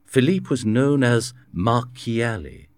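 ordinary delivery

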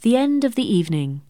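usual speaking voice

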